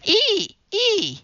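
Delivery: very high-pitched chimp call; chimpanzee yowl. A narrator's reading. Tommy calls classmates into ape game, chimp yowl. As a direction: squeal like a monkey. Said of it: "yowling like a chimpanzee".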